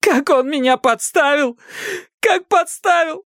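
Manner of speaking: very high, slow sobbing wail; hysterics. A narrator's reading. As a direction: howl the accusations through tears. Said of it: "У неё началась истерика".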